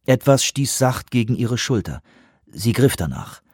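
usual speaking voice

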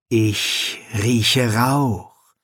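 drawn-out, very slow, ominous; very slow mirror voice. Acted. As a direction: stretch every word slowly. Mirror answers slowly.

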